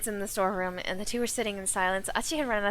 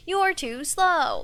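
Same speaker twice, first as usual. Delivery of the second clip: slow, drawn-out cocky taunt; Sonic taunt; 7 semitones higher, 5 dB louder, much slower than usual. Retold or imitated. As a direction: stretch every word, smug. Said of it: "specifically in this inflection".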